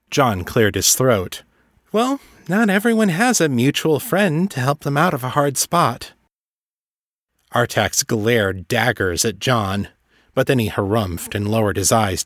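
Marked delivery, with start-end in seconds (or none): 1.9-6.1 higher-pitched character voice, lightly put on; a touch of reproach, pitched up; pointed retort. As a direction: lift the voice for a pointed comeback. Character retorts to old master.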